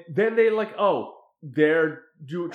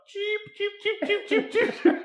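chicken noise. Retold or imitated. Friends teasing Peter again, high peeps. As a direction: peep it high like a silly chick. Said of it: "the weirdest chicken noise ever".

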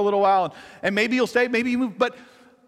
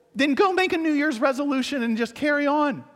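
usual speaking voice

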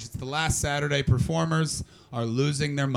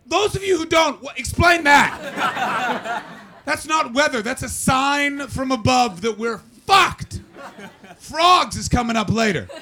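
mock doomsday shout; 11 semitones higher, 6 dB louder than usual. Real, not acted. Mock doom about climate.

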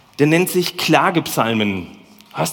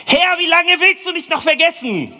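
screamed lament, much higher and louder; anguished shouted lament to God; 12 semitones higher, 3 dB louder than usual. Shown as a demonstration. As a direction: cry the psalm out at the top of the voice. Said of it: "Und jetzt darf ich mal schreien".

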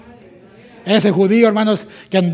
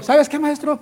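higher, pointed interruption; mock indignant Marta; 7 semitones higher than usual. Marta interrupting Jesus mid-message, higher and indignant. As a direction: butt in on the teacher. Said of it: "le dijo".

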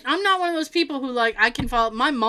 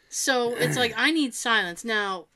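ordinary delivery

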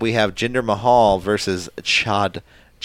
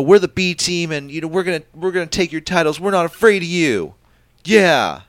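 higher, louder B team taunt; wrestler impression; 10 semitones higher, 6 dB louder than usual. B team challenging rivals for their titles, loud mock bravado. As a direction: play a cocky tag team calling out opponents. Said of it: "trying to say, you know".

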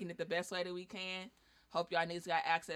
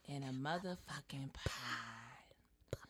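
breathy whispered show name; 5 semitones lower, 10 dB quieter, much slower than usual. Acted. Host whispering the show's name to close the intro. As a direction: whisper the end of the title like a secret. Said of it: "Oh, I should do that every time".

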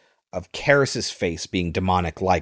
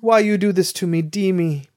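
higher-pitched plaintive mother; mother's voice; 9 semitones higher than usual. Acted. Possessed girl talks to the priest as his mother, high mother's voice. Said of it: "she talks as his mother".

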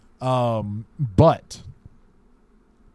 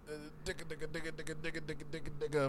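very soft, rapid rhythmic digga-digga; hushed patter mimicking soundtrack strings; imitated film theme; 3 semitones higher, 18 dB quieter, much faster than usual. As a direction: imitate a movie score under your breath. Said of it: "then you hear, um, the classic".

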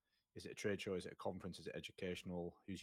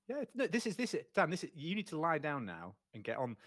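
excited; 9 semitones higher, 7 dB louder than usual. Real, not acted. Excitedly ordering guest onto couch.

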